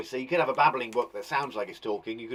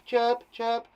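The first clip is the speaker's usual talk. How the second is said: higher, light chirp, chirp; chirping imitation; 8 semitones higher than usual. Shown as a demonstration. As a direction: chirp it like a little bird. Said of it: "is the onomatopoeia".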